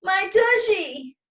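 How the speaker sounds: high, loud, slow wail; wailing complaint. A narrator's reading. Lexi wails to everyone about her sore behind. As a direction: whine it out, hurt and indignant. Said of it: "Lexi shrieked".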